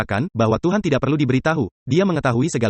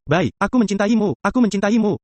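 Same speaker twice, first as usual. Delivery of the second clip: higher, doubled I love you; mock loving reply; 7 semitones higher than usual. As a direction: repeat the I love you fondly. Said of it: "Makanlah sandwich".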